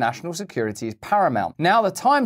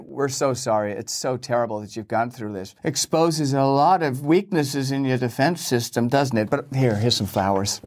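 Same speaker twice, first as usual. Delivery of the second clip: lower, sarcastic false pity; mock condolences; 3 semitones lower than usual. Host mocking the Financial Times' condolences, lower sarcastic pity. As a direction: sneer through fake sympathy. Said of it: "These condolences are starting to wear thin".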